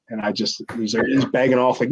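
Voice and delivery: usual speaking voice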